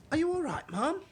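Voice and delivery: high, loud concern; worried maid. Acted. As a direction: check on the Queen.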